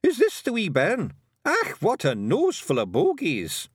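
high, cocky Scots brogue; a proud wee Feegle warrior; broad Scots Feegle; Scottish pixie voice. A narrator's reading. Rob Anybody's Feegle voice.

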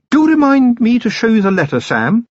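loud, pointed, high-pitched warning; sweetly firm, a clear hint. A narrator's reading. Sybil warns pointedly.

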